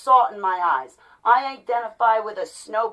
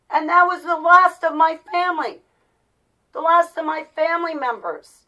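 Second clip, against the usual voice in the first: high, pained voice, still raised; real distress; 7 semitones higher, 6 dB louder than usual. Real, not acted. Vlogger telling viewers of losing the last family, distressed. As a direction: hold the pitch up high in upset.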